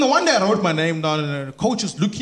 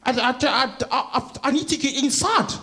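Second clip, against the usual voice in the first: put-on high, stuttering old lady; flustered, put-on lady pleading; very anxious; 5 semitones higher than usual. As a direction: stammer anxiously, pitched up. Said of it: "So she's very anxious".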